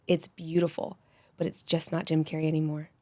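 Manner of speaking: normal talking voice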